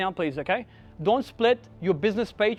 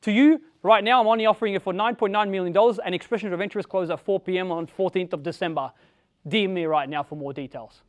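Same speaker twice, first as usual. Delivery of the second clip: loud, punchy property pitch; pumped-up real estate ad read; sales pitch; 3 semitones higher, 7 dB louder than usual. Shown as a demonstration. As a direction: sell it hard on a reel like an agent.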